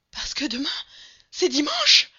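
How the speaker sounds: high and loud, rising to a cry; frantic outburst. A narrator's reading. Servant explaining to Mr. Fogg, frantic and loud. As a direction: build to a loud cry on the last word.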